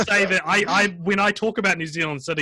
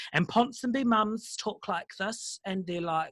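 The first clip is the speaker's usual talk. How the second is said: Ponsonby mum voice; 7 semitones higher, 5 dB quieter than usual. Retold or imitated. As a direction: lilt it like a wealthy Auckland mother. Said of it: "the Ponsonby mum stereotype".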